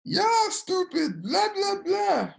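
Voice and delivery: very high, drawn-out caricature of yelling; imitated tirade in a high-pitched voice; mock ranting husband. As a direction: squawk it out as a cartoonish husband's rant. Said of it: "comes out and is like".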